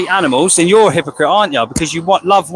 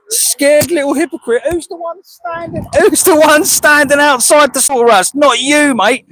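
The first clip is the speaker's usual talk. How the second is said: heated yell at a passerby; angry shout; 9 semitones higher than usual. Real, not acted. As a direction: shout down the heckler. Shouting back at a heckler.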